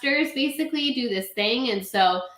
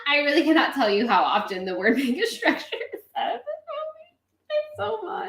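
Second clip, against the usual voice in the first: laughing; 4 semitones higher than usual. Real, not acted. Laughing disbelief at movie.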